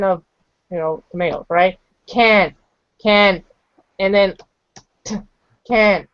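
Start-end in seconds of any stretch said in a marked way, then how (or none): 2.1-6.1 "can" then "t" then "can't", step by step; built-up can't. Shown as a demonstration. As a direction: build "can't" slowly from "can". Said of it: "I see the word can".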